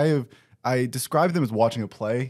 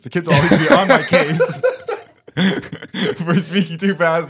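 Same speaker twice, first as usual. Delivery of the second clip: talking through real laughter, higher and louder; laughing; 4 semitones higher, 5 dB louder than usual. Real, not acted. Podcast host laughing while recalling campers complaining, laughing. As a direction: laugh your way through the correction. Laughing at own bad phrase.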